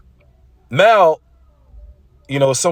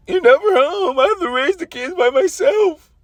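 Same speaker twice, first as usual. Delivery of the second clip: crying, much higher shriek of a furious wife; screaming wife; 13 semitones higher than usual. Retold or imitated. Soldier's wife screaming at her absent husband, crying and shrill. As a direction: wail it at him through tears. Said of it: "his wife was probably".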